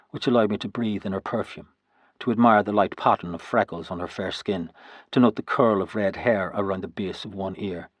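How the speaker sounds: ordinary delivery, nothing marked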